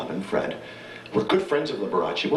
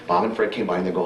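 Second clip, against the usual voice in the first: normal talking voice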